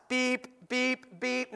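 three high, even beeps; imitated bottle-warmer beeps. Dad describes the bottle warmer finishing, high imitated beeps. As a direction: imitate an appliance timer beeping. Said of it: "it beeps three times".

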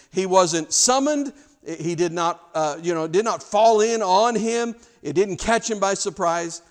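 loud, high, driving pulpit delivery; full-force preaching. Acted. Preacher proclaims Jesus chose his path, loud and high. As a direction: drive each point home to the crowd.

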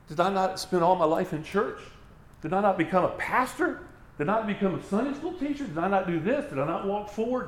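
imitated pastor's high-pitched protest, question after question; pleading self-justification. Acting out pastor pleading his works.

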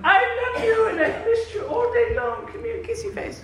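high, gushing lovey-dovey wife. Pretend. Husband imagining a wife at the door, gushing high and sweet. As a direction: coo it like a doting wife greeting you. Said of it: "your wife is not standing at the doorway going".

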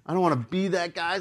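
usual speaking voice

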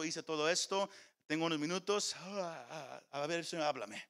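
mock impatient reader mumbling; hurried bored believer. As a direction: rush through it like you have somewhere to be. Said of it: "Una actitud que dice".

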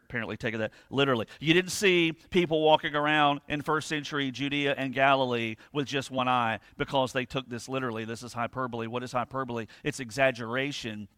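ordinary talk

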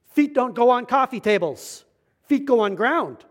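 loud, high stern German scolding; Clemens's German accent. Retold or imitated. German roommate objecting to feet on the table, loud accented scolding. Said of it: "this guy was, like, German, German".

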